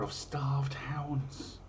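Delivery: hushed conspiratorial whisper. Acted. Host whispering the omen's punchline to listeners, whisper. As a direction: drop to a secret whisper for the twist.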